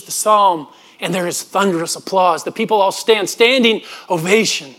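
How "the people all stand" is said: ordinary talk, nothing marked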